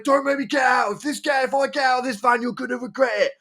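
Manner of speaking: high-pitched mock threat; imitated angry threat. Van driver threatens to climb out, high mock fury. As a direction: strain the voice up high like a ranting driver. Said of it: "the angry guy".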